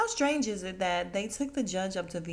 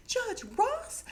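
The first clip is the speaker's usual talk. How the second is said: very high incredulous question; mock shock. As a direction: act out stunned recognition. Patty recognizes the judge, acted.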